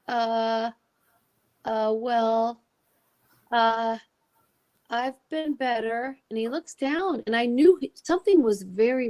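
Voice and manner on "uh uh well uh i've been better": drawn-out, halting imitation of an awkward man; hesitant, uneasy. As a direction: stall on each filler, reluctant to answer. Imitates Jerry's awkward, halting reply.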